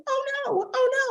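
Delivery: high, hammed-up "oh no"; mock alarm. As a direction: squeal it like a kid watching in alarm. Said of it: "And you're like".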